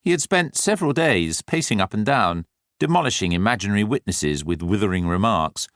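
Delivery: ordinary delivery, nothing marked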